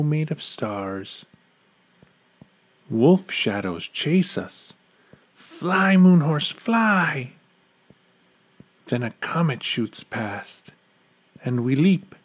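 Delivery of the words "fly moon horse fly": high, loud urging call; urgent cry. A narrator's reading. Rider fleeing wolf shadows on the moon horse, urgent cry.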